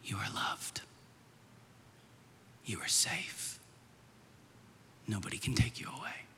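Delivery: put-on hushed whisper into a sleeping child's ear. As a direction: whisper tenderly, with long pauses. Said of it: "I would whisper into her ear".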